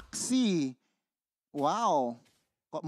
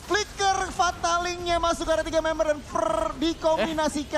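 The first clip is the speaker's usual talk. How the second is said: hyped play-by-play, higher and louder; fighting game announcer; 9 semitones higher, 5 dB louder than usual. Real, not acted. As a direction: call the teamfight like a caster. Caster calls the big teamfight.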